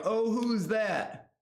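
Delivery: high, drawn-out mock curiosity; exaggerated, singsong puzzlement; mock wondering. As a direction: stretch it out high like a puzzled onlooker. Mimicking others' reaction to someone.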